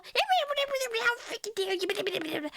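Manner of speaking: slow, high, hammed-up outrage; mock "how freaking dare you"; mock rant. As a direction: parody her own angry outburst. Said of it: "I don't go".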